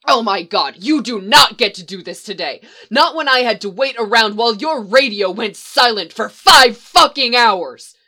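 angry shouting match; furious shouting. Acted. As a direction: yell it all out, rising. Angry quarrel.

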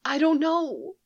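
put-on high, tearful little girl; close to tears. Lost girl tells Leia, high and close to tears. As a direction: hold back tears as a child. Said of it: "sounding close to tears".

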